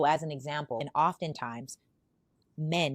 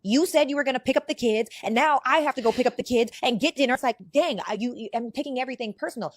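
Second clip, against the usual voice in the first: upset woman; 7 semitones higher, 6 dB louder than usual. Retold or imitated. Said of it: "Femininity takes things personal".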